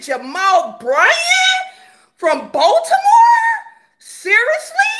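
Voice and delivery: high, loud disbelief; incredulous shout. Real, not acted. Asking her viewers about the pastor, incredulous high shout. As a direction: throw the question up in shock.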